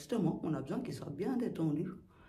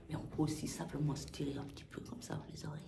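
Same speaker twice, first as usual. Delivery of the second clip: soft whisper; 4 semitones lower, 10 dB quieter, faster than usual. Real, not acted. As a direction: drop to a whisper. Whispers during the exercise.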